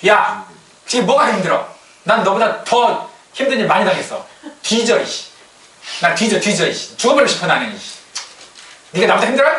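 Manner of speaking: hammed-up irritated rant; mock angry boss. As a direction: snap back at a whining junior. Imitating a scolding boss.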